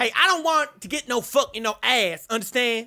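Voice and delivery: a put-on Kanye voice, brash and cocky; [name] impression. Trash-talking strangers in game chat, brash Kanye voice. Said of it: "My name is [name]".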